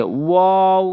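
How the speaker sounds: ওয়াও with round lips from the open mouth; rounded waw. Shown as a demonstration. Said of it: "মুখ গোল করে".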